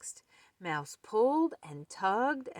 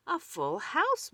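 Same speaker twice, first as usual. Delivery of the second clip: excited; 11 semitones higher than usual. Acted. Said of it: "Mole clapped".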